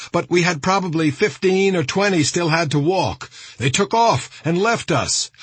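high, urgent radio report; alarmed. A narrator's reading. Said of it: "broadcast his alarm in disjointed phrases".

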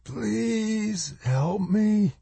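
high, slow, drawn-out plea; pained rasp. A narrator's reading. Injured woman pinned under rock begging for help, pained rasp. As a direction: beg softly and slowly, high and strained. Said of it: "the bloody mouth rasped".

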